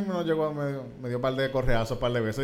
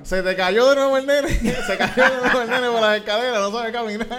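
laughing mock excuse; 8 semitones higher, 8 dB louder than usual. Mom excusing to others.

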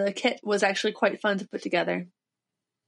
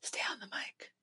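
whisper; 19 dB quieter than usual. Real, not acted. Aside off mic.

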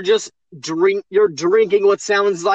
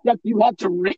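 normal talking voice

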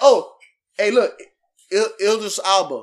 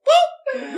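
playful boop; 7 dB louder than usual. Pretend. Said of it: "you see I did that".

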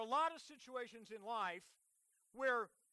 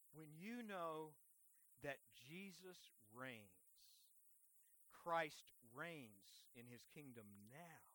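very low, slow, hushed declaration; solemn preaching; 8 semitones lower, 9 dB quieter, slower than usual. Real, not acted. Preacher tells the church that Christ reigns, solemn preaching. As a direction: drop right down and let each phrase settle.

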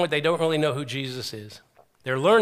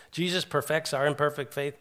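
usual speaking voice

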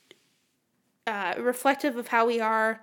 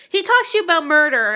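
weird dramatic; 6 semitones higher, 10 dB louder than usual. Pretend. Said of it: "I don't know why I'm being weird about this".

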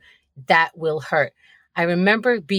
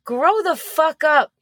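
exasperated scolding; 9 semitones higher, slower than usual. Acted. Indignant rant at parents.

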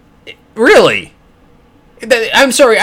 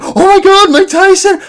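high, loud hammed-up fan squeal; starstruck freak-out; 6 dB louder than usual. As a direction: freak out at spotting your idol. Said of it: "I'm gonna freak out and be like".

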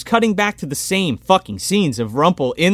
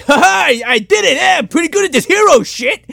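high, hammed-up boasting quote; exaggerated self-pleased movie voice; mock triumph; 9 semitones higher, 3 dB louder than usual. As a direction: imitate a giddy hero congratulating himself. Acted movie hero's self-congratulation.

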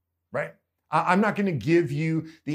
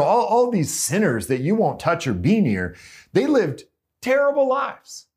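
louder, climbing sermon voice; preaching at full force; 2 semitones higher, 6 dB louder than usual. Acted. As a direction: drive it home to the congregation.

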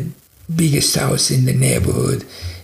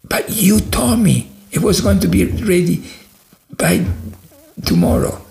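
raised, indignant accusation; ready to fire everybody; 6 semitones higher, 3 dB louder than usual. Retold or imitated. Boss to team who missed tomorrow's deadline, angry reproach. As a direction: start high, drop emphatically on the last word. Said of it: "he was just ready to fire everybody".

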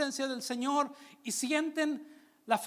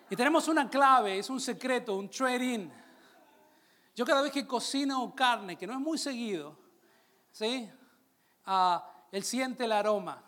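normal talking voice

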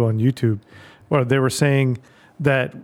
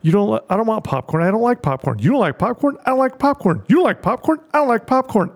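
two characters bickering, pitched up and climbing higher; acted-out sitcom exchange; 7 semitones higher than usual. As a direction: escalate a silly back-and-forth argument, higher each round. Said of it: "I call it the popcorn theory".

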